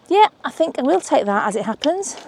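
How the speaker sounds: ordinary talk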